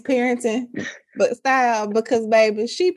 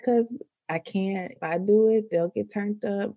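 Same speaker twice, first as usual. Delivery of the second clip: soft, unbothered imitation; monotone; 6 dB quieter than usual. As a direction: quote a calm mom, voice kept down.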